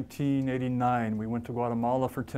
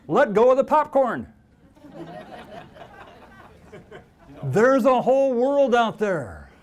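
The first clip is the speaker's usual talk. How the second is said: full force preaching, louder; 4 semitones higher, 6 dB louder than usual. Acted. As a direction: preach it out with conviction. Preaching message.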